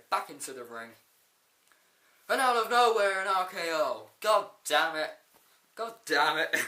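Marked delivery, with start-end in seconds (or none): 2.3-6.5 loud, high outburst at the surprise RKO; excited shout. Real, not acted. Reviewer yelling at the surprise RKO, loud excited shout. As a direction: yell it out, caught off guard and thrilled.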